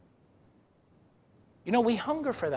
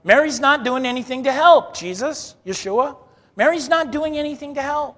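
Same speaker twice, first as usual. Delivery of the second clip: higher, louder whiny complaint about her sister; mock Martha complaint; 6 semitones higher, 5 dB louder than usual. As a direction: play Martha griping to Jesus that Mary won't help. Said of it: "she's like".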